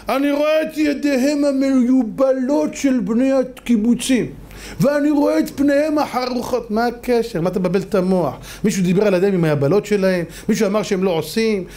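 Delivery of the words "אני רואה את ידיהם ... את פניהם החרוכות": slow, high-pitched speechifying put-on voice; mock a drawn-out presidential speech; הנשיא הרצוג. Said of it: "בא הנשיא הרצוג". (חיקוי נאום נשיאותי, גבוה ומתוח)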